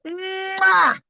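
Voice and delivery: kiss sound. Real, not acted. Blowing a kiss.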